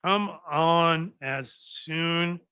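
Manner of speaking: ordinary delivery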